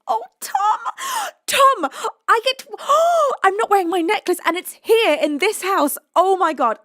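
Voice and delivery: loud, high gasping exclamations; overwhelmed surprise. Real, not acted. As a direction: gasp and cry out, stunned by a gift. Stunned by Tom's donation.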